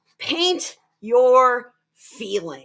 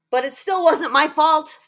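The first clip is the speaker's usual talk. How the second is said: louder, higher insistent denial; defiant protest; 5 semitones higher, 6 dB louder than usual. Acted. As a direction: push back stubbornly, like a child. Jack protests again in his note.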